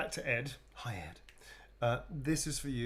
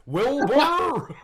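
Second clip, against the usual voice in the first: loud, higher talking-horse call; Mister Ed; 14 dB louder than usual. Acted. Showing off a talking horse impression, loud horse voice. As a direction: holler it like a cartoon horse. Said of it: "I can do Mister Ed's voice".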